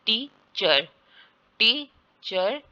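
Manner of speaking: "टीचर" slowly split as टी, चर; syllable by syllable. Shown as a demonstration. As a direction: sound out "टीचर" in two separate beats. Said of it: "शा sound जिसको बोलते हैं". ("टीचर" को तोड़कर धीरे बोलें)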